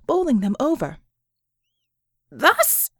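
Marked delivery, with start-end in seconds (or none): 2.3-2.9 startled cry. A narrator's reading. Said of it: "Doppelganger cried".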